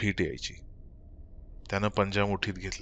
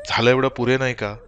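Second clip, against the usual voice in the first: louder snap stressing एवढं; snapping; 4 semitones higher, 7 dB louder than usual. Acted. Husband snaps at his grieving wife, loud and angry. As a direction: bite out एवढं hard and loud. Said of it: "Sakharam खेकसला".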